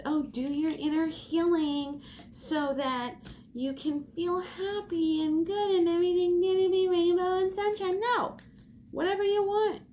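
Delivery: high singsong mockery of fluffy positivity; high mocking sing-song voice. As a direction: sing it out sweetly to mock it.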